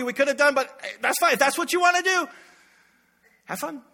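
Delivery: mock sweetness hiding resentment; needling spouse feigning it's all fine; passive-aggressive. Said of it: "the passive-aggressive, like".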